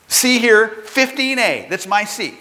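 high, emphatic mock indignation; retold insistence. McGuigan showing the lady his boarding pass, insistent.

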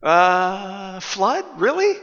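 rising pitch, disbelieving; he can't believe a flood is coming; incredulous Noah. Acted. Noah doubting God's flood warning.

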